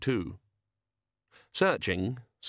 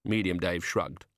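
ordinary delivery, nothing marked

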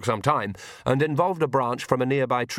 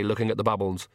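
ordinary delivery, nothing marked

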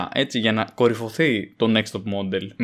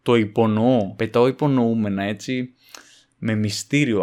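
usual speaking voice

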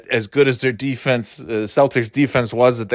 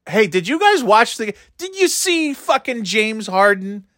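mock sports-radio host; 8 semitones higher than usual. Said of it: "It's like".

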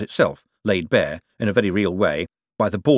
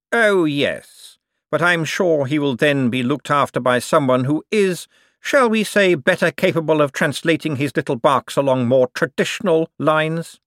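higher, emphatic, silky superior voice; smug, scheming Cosmo; 7 semitones higher, 4 dB louder than usual. Acted. Cosmo tells Bent who will look after the chairman, smugly scheming. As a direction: savour the euphemism, pitched up.

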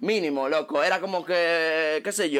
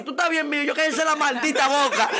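high, laughing imitation of crying; mock-wail it through laughter; hammed-up tearful protest; retold tearful outburst; 10 semitones higher than usual. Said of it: "ahí me pongo yo a llorar".

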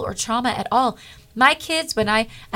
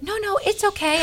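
much higher, mock-dainty no thanks; imitating polite refusal; 12 semitones higher than usual. Reluctant woman declining prayer, imitated high and polite. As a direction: wave it off in a high put-on voice.

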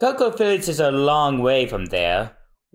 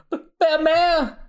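sudden high alarmed cry; startled cry. Acted. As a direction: gasp the name out in alarm. Spotting smoke from her house.

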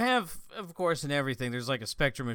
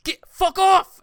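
much higher, very loud yell at the snake; mock shout; 14 dB louder, slower than usual. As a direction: scream to scare it away. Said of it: "He's like".

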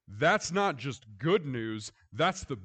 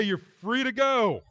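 louder, higher announcement of release; guard's announcement; 10 semitones higher, 9 dB louder than usual. Retold or imitated. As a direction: declare it like a guard at the door.

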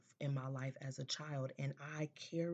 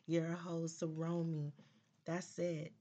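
normal talking voice

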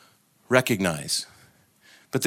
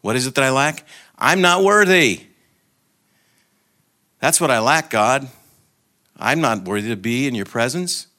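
higher, louder pleading protest; soul protesting unworthiness; 3 semitones higher, 4 dB louder than usual. Acted. The soul answering God's question, protesting unworthiness. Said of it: "I answered".

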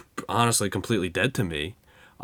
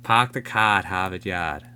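lightly voiced Boston accent; a hint of Boston, dropping the r's; mock Boston accent. Joking about Boston talk in a light Boston accent. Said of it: "whatever Boston sounds like".

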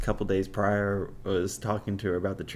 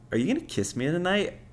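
lightly mimicked date, higher and quick; higher, hurried question, imitating her; imitated expectant date; 8 semitones higher, faster than usual. Date asks him for a kiss, lightly imitated.

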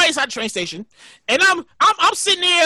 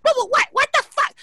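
high-pitched scolding mom voice; exasperated mother impression; 10 semitones higher than usual. Mom scolds yelling son on phone, high mock exasperation. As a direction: imitate mom fussing at her child, pitched way up.

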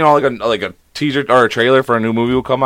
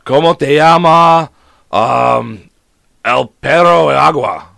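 slow, louder attempt at a Spanish accent; put-on Spanish accent; 3 semitones higher, 4 dB louder, much slower than usual. Shown as a demonstration. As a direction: carefully stretch out the Spanish-accented syllables. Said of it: "I gotta change my lingo a little bit".

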